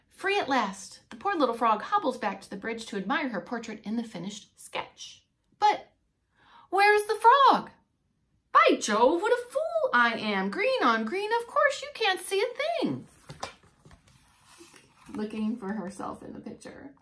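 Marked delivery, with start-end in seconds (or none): 5.6-13.1 higher, loud exclaiming painter; a painter scolding himself, out loud; puzzled old painter. Acted. Painter Mister Claude baffled by his own painting, loud and high.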